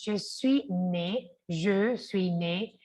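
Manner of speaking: je suis né, very slowly and clearly; slow model. Shown as a demonstration. Teacher modelling je suis né slowly. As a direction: draw out je suis né. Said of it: "yo nací, entonces".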